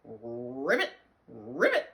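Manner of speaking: low, loud frog croaks; frog ribbit. Acted. Dog George answering his mother, low frog croaks. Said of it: "And George said".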